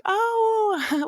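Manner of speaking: a high, startled "Oh" from a woman caught off guard; surprised oh. Retold or imitated. Bakery story, working woman startled by the toddler, surprised high oh. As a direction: let the "Oh" jump up in polite surprise.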